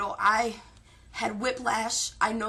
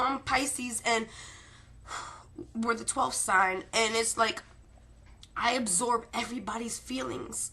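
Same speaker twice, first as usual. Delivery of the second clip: ordinary talk, nothing marked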